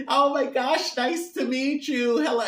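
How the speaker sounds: high-pitched, loud mock Irish greeting; badly faked Irish accent. Irish family meeting someone they admire, badly faked Irish accent. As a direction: gush in a clumsy Irish accent. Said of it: "I can't do an Irish accent".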